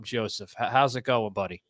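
ordinary delivery

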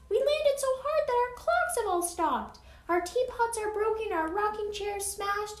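very high, small voice from the speck; tiny mayor. A narrator's reading. Tiny mayor reporting the crash to Horton, high small voice. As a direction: squeak out your troubles as a tiny mayor. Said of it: "came the voice of the mayor".

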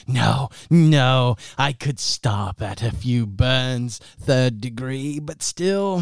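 high, gloating villain, drawn out; villain toying with a captive; sadistic villain. Acted. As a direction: savor the threat slowly. Game master voices a villain.

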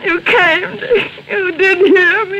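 slow, tearful relief; crying thanks to her rescuer; relieved sob. Acted. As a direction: weep with relief.

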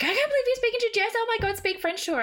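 high, fast, gushing friend; excited friend. Retold or imitated. Friend urging her to speak French, high and fast. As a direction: rattle it off high and fast, thrilled. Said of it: "Ange was like".

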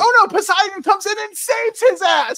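high, loud sarcastic exclamation; hammed-up dismayed recap; mock alarm. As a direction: cry it out in fake panic. Mocks the rescue in recap.

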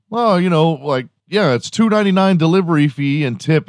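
mocking app voice. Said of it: "They're like".

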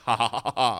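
low, deliberate fake ha-ha; imitated laugh. Comedian describing replies to stories, mock laughing. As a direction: act out a typed laugh.

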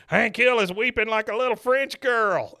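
high, loud jeering shout; hammed-up public taunt; mocking announcement. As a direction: act out tattling to the whole room. Dale announces it to everybody.

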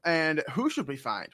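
normal talking voice

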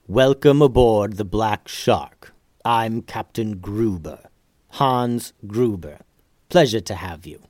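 slow, measured captain's welcome; unsmiling captain, formal and deliberate; serious ship's captain. Acted. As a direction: greet them slowly and gravely as master of the ship. Ship's captain greets the ninjas.

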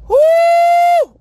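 long, high, loud "woo"; high whoop. Acted. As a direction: let out a sustained high whoop. Exclaims over stomped toe pain.

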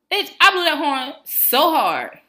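loud, high, drawn-out exclamation; heated outburst. Real, not acted. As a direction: belt it out slowly for comic outrage. Angry retelling of wrong order.

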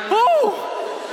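high startled gasp of an oh. Retold or imitated. Jumper startled as friends fall from the plane, high gasp. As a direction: jolt of alarm.

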